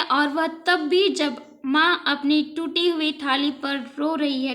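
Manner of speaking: usual speaking voice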